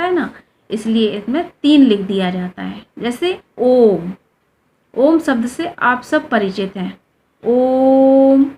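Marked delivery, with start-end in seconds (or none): 3.0-4.1 ओम stretched very long, pluta style; drawn-out om. Shown as a demonstration. Teacher demonstrating a long held ओम to students. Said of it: "इस तरह से इसका उच्चारण होता है". 7.4-8.5 ओम held long and louder, chant-like; prolonged om. Shown as a demonstration. Teacher showing students how ओम is prolonged. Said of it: "इस तरह से इसका उच्चारण होता है".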